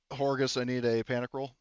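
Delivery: usual speaking voice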